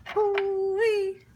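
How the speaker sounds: high, loud hoo-wee whoop; excited whoop. Real, not acted. Cook hyping up the dish to viewers, loud excited whoop. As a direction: whoop with delight at the food. First taste exclamation.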